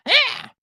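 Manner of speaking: sudden high yelp like a hurt cat. Retold or imitated. Imitating the cat's yelp when its tail is stepped on. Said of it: "immediately goes".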